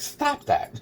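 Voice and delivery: high-pitched mock protest; retold protest. Himself to the cop grabbing him, high retold protest. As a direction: squeal it upward as an imitated objection.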